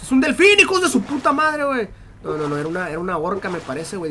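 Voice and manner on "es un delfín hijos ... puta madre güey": higher, louder shouted insult. Real, not acted. Gamer shouts insults at a dolphin in the game, loud. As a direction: yell it at the animal.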